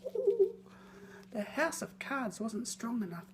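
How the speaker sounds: usual speaking voice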